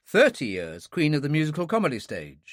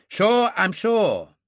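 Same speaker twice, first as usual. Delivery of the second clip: slow, louder, annoyed "Sure, I'm sure"; irritable; 6 semitones higher, 6 dB louder, much slower than usual. A narrator's reading. Doctor answers the suspicious lady, irritable. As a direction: snap back impatiently. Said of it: "I said irritably".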